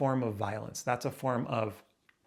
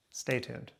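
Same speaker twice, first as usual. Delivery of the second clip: normal talking voice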